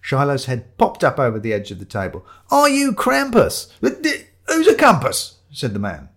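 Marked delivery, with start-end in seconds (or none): 2.5-3.7 high, loud, drawn-out question; abrupt demand. Acted. Shiloh pops up, questions a stranger. 3.8-5.4 loud, high, slow bewildered question; taken aback. Acted. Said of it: "taken aback".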